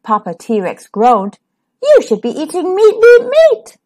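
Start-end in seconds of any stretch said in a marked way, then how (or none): 1.8-3.8 rising, louder insistence on meat; Mama pleading for meat, building louder; moaned demand. A narrator's reading. Mama T-Rex moaning at Reginald, rising to a loud plea. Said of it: "Mama T-Rex moaned".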